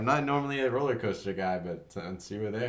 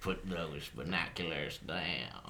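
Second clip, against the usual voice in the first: slow, far-off cowboy drawl; cowboy bandit voice; much slower than usual. Pretend. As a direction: play a menacing outlaw. Bandit ambush, gruff standoff.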